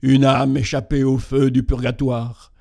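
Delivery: loud, hollow ghostly voice; sepulchral voice. Acted. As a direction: speak like a ghost from the grave. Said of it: "dit une voix sépulcrale".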